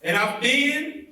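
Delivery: rising preacher's fervor, building to the peak; rousing preaching. Acted. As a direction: lift the voice toward the mountaintop. Sermon climax rising to peak.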